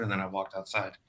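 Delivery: ordinary talk, nothing marked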